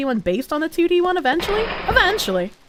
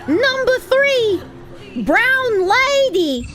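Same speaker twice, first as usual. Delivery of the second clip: cute anime streamer; 7 semitones higher, slower than usual. Real, not acted. Reading sub tier name.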